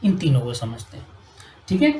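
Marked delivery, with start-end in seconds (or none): none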